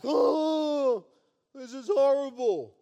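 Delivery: high, loud overdone moan; mock despair. Preacher mocking complainers, loud hammed-up moan. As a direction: ham up the dismay. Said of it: "And they're like".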